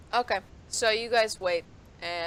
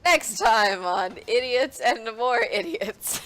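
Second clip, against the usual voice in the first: trailer announcer; 4 semitones higher, 6 dB louder, slower than usual. Pretend.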